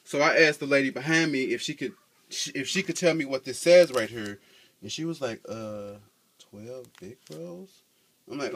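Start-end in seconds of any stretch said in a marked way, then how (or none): 5.5-7.8 soft, slow, unsure reading with a rising question; a hesitant stranger squinting at the sign; puzzled bystander. Retold or imitated. Bystander asked to read the sign, soft unsure mumble.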